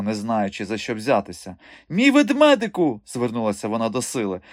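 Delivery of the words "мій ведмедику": high, loud, fussing plea; flustered appeal. A narrator's reading. Flustered directress turning to Syla, flustered appeal. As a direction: call on the strongman in a tizzy. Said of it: "метушилася директриса".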